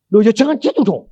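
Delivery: loud, high-pitched pleading; wife's alarmed protest. Retold or imitated. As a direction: protest loudly as the upset wife. Wife protests at husband dying. (protes istri yang panik, lantang)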